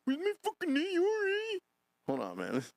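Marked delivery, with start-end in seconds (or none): 0.0-1.6 high whiny squeaky imitation; mock him like a tiny Joker; Baby Joker squeal. Streamer mocking the clip guy as Baby Joker. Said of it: "Sound like Baby Joker".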